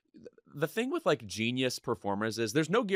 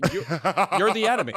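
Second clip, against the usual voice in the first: raised, higher-pitched comic protest; mock outrage; 7 semitones higher, 5 dB louder, faster than usual. Snapping back at a mother's praise in mock outrage. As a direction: blurt it out in mock indignation.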